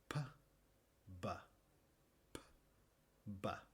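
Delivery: alterna "P" sorda y "ba" sonora; contraste p y b. Shown as a demonstration. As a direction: marca la diferencia p sorda, b sonora. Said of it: "un sonido similar, solo es sonoro". (p sin voz frente a b con voz)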